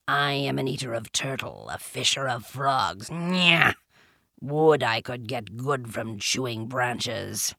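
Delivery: low panther voice with disdain; low, sour panther complaint; Bagheera the panther, disgusted. A narrator's reading. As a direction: grumble low, like a disgusted big cat.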